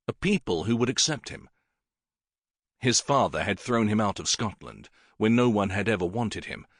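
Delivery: usual speaking voice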